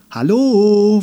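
high, loud questioning call; drawn-out hail. A narrator's reading. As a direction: call out long and loud for an answer. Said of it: "fragte sie noch einmal".

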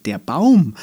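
plain reading voice

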